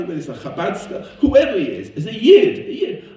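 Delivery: loud, high, heated sermon; impassioned preaching. Real, not acted. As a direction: drive the point home at full force.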